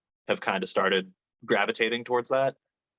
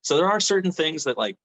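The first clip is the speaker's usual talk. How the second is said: ordinary talk, nothing marked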